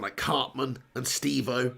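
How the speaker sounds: ordinary talk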